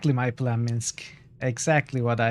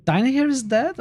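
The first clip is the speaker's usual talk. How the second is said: higher, shocked question; 12 semitones higher, 4 dB louder than usual. Acted. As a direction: gasp it out in disbelief. Player character hears of death.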